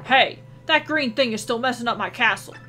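lightly voiced gruff king; a hint of a blustering king; Bowser voice. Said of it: "I'm Bowser now".

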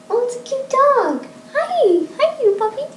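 very high cooing puppy talk. Real, not acted. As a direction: coo at a cute puppy. Cooing at the dog.

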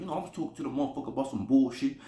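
quick mimicry of a friend telling a story; imitating friend's talk. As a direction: rattle it off as he would. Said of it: "'cause this is how he talk".

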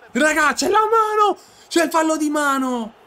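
high, loud protest; outraged shout. Real, not acted. Gamer protesting to the referee, outraged shout. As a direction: yell for the handball call. Claiming a handball.

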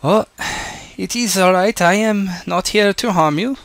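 put-on German accent, hands-up reassurance; Ingrid Becker. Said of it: "she says in a German accent".

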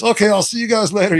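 high cheery farewell to animals. Retold or imitated. Charlie Tom dismisses the animals.

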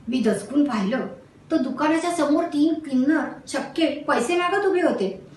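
ordinary delivery

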